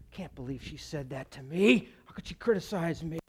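softly acted inner grumble, indignant; stewing, wounded. Husband stewing to himself over his wife's criticism, muttered hurt grumble. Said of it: "I'm stewing about it".

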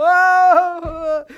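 high loud mourner's wail; a sudden high wailing shriek; mock wail. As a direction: cry out like a hired mourner. Imitating mourners screaming.

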